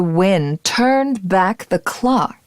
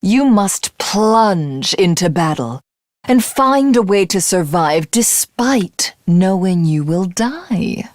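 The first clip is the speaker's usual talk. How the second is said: ordinary talk, nothing marked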